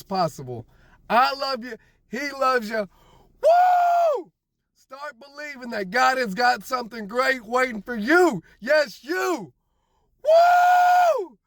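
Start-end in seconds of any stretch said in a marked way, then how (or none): 3.4-4.3 high, loud whoop of joy; joyful whoop. Real, not acted. Celebrating a year sober on the ride home, big high whoop. 10.2-11.4 high, loud whoop of joy; joyful whoop. Real, not acted. Celebrating a year sober on the ride home, big high whoop.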